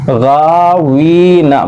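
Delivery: गावीना with गा and वी stretched, ना short; slow, measured recitation of गावीना; drawn-out long vowels. Shown as a demonstration. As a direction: hold the aa and ee, release ना quickly. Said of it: "खींच कर पढ़ेंगे".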